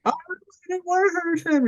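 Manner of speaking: very high, whiny imitation of crying; mock crying. Imitating the crying friend who broke his elbow, high mock wail. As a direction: squeal it like a tearful kid, hammed up. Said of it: "like, crying".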